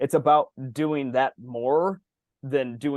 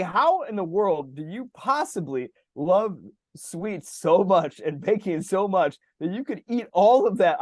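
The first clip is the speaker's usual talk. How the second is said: mock amazement; 6 semitones higher, slower than usual. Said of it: "I'm like".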